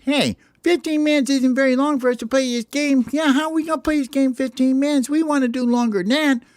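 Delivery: very high, squeaky protest; whole team whining together; complaining birds. Acted. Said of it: "And everybody says".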